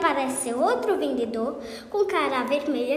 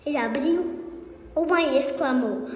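ordinary delivery

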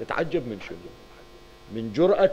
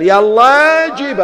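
loud, slow, much higher defiant command; defiant order; 12 semitones higher, 10 dB louder, much slower than usual. Acted. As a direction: bark the order, dragging it out. Abbas defiantly orders the will brought. (أمر متحدٍّ بصوت عالٍ وبطيء)

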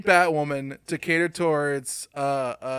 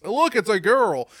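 loud, raised mock announcement; hammed-up, high and loud showing off; in-your-face pointing; 5 semitones higher, 8 dB louder than usual. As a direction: shove it at the listener with fake excitement. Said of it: "pointing in your face".

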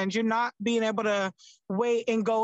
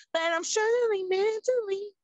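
higher, slow, half-joking admission; mock confession; 10 semitones higher than usual. Real, not acted. Admitting to the host she struggles, half-laughing. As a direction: lightly toss off a heavy truth.

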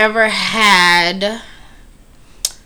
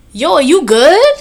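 slow, loud and higher "yo, are you good"; confronting; 7 semitones higher, 6 dB louder than usual. Retold or imitated. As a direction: call him out, slowly. Confronting the man's foot.

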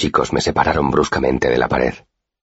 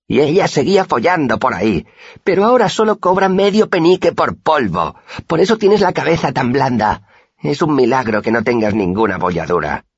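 triumphant taunt; 10 semitones higher than usual. A narrator's reading. The first boy gloating over his friend, high and triumphant. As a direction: finish the insult with a gloat. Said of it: "concluyó triunfante".

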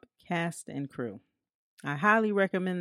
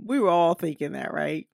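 normal talking voice

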